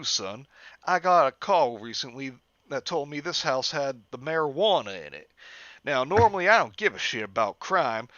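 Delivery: lazy Southern cop, menacing. Acted. Officer voiced with hick drawl.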